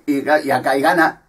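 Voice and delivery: ordinary talk, nothing marked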